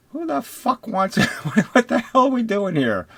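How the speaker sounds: high, laughing incredulous yelp; laughing outburst. Real, not acted. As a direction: burst out laughing in disbelief. Laughing mid-rant.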